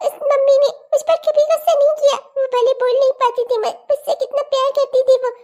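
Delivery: put-on a small child crying and scolded, refusing to go; crying little girl. Daughter cries at her mother's threats, high tearful child voice. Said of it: "इसका खड़ा होकर रोना".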